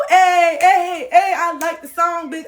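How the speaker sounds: loud, high party shout; hyped clubgoer. Pretend. Dancer at the club shouting for the song, high and loud. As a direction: hype up the dance floor.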